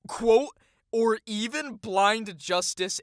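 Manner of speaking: high, loud incredulous read-out; high exaggerated quotation; mocking quote voice. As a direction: raise the voice to sneer at the quoted phrase. Quoting the article with scorn.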